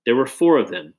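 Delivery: ordinary delivery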